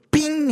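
sharp, high ringing ping; high sproing of a flying lever; high-pitched "bing". Retold or imitated. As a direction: imitate a spring popping loose. Imitates spring-loaded lever popping.

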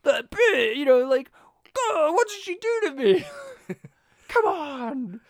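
high, loud hammed-up wail; fake-sob about being cursed; mock crying. Dumb guy cursed by devil.

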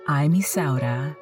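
ordinary delivery

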